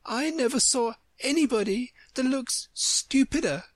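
very high little flower voice; sudden insulting Violet. A narrator's reading. A Violet butting in on Alice, scornful. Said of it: "so suddenly that Alice quite jumped".

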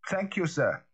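high-pitched, triumphant thanks; note of triumph. A narrator's reading. Butler thanks the voice within, with a note of triumph. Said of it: "something like triumph in his voice".

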